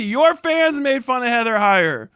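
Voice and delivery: ordinary talk, nothing marked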